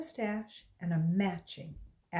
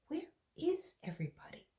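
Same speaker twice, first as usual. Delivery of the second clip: worried aside; 11 semitones higher, 7 dB quieter, faster than usual. A narrator's reading. Alone, worried in empty class.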